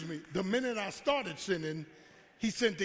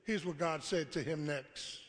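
ordinary talk

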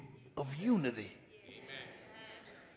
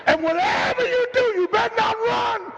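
yelling parent. Retold or imitated. Mocking a parent yelling at the child mid-beating. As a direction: yell it like a furious mother.

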